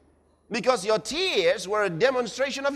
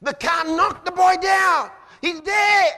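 panicked shout; 8 semitones higher, 6 dB louder than usual. Acted. Mourner shouting about a death.

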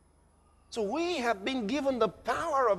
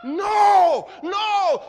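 acted panic; 10 semitones higher, 6 dB louder than usual. Mourner denies the death.

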